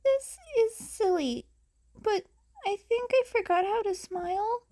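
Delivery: high, shy quick confession; small child voice. Acted. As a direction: say it shyly as a girl.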